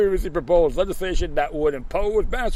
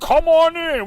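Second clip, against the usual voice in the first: 1 mock welcome; 12 semitones higher, 7 dB louder, slower than usual.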